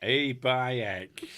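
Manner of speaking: loud put-on northern English accent; mock northern accent. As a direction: exclaim it in a hammed-up northern accent. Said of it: "sounds like a northern person doing an accent".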